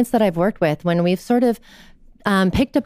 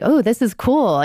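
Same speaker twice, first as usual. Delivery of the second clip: higher, lightly voiced delighted little girl; innocent child; 7 semitones higher, 4 dB louder than usual. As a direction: imitate a small child's happy discovery. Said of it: "as an innocent child does".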